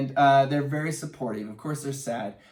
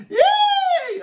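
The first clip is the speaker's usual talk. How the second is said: shrieked, much higher and louder; a burst of real joy; excited yay; 7 dB louder than usual. Real, not acted. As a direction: squeal with delight. Excited cheer over fan letter.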